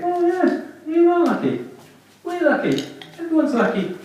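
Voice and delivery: high mock agreement; sarcastic thought. Audience member sarcastically humouring a lucky comedian, high. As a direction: roll your eyes at the comedian.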